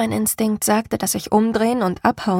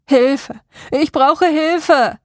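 slow, high, loud, identical repeated plea; eerie monotone cry; 6 semitones higher, 6 dB louder, much slower than usual. A narrator's reading. Uncanny voice repeats the same plea in the forest. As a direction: call for help like a recording on repeat. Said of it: "klang zu eintönig".

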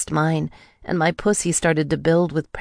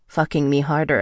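ordinary delivery, nothing marked

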